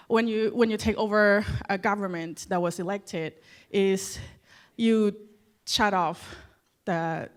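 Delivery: normal talking voice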